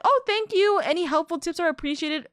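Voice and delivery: lightly voiced excited newbie thanking; mock chipper thanks. Streamer voiced thanking for tips.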